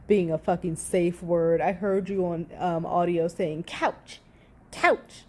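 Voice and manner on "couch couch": put-on whiny, high, babyish couch couch; mock a whining toddler demanding the couch; imitating a toddler. Vlogger mocking Amber on the audio, whiny toddler imitation. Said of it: "like a fucking toddler".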